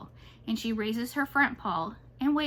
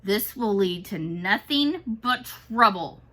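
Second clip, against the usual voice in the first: growl; 6 dB louder, slower than usual. A narrator's reading. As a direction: growl it out slowly. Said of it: "Amlet's voice now sounds like a growl".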